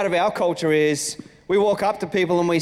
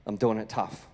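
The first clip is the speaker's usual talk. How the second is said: soft, lower, heavy confession; quiet admission; 7 semitones lower, 7 dB quieter than usual. Retold or imitated. Friend admitting to the pastor they struggle, softly. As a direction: speak it softly, with the weight of it. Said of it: "had the courage to actually say".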